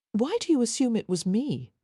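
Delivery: mock-offended protest; faux indignation. A narrator's reading. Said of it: "protested, with faux indignation".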